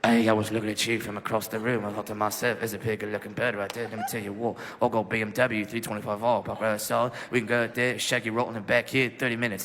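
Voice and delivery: put-on low, gruff South London British accent. British soldier flirting in a low South London British accent. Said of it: "that South London Jason Statham type British accent".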